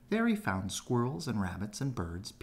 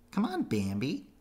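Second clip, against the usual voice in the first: higher, louder bunny call; Thumper calling; 6 semitones higher, 4 dB louder than usual. A narrator's reading. Thumper urging Bambi on, high calling voice. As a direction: call him over the log. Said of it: "Thumper called".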